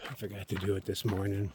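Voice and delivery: ordinary talk, nothing marked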